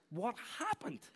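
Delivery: high, drawn-out incredulous question; mock-bewildered, pitched right up; baffled astonishment. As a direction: stretch it out as the bewildered newcomer. Third colleague arrives bewildered.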